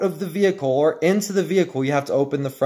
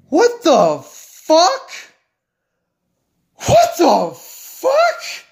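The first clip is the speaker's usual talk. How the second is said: high, slow, loud disbelief; incredulous; 14 semitones higher, 5 dB louder, much slower than usual. Real, not acted. Reacting in disbelief to the door design, high drawn-out what. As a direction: stretch out the outrage. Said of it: "I would never buy the F one fifty".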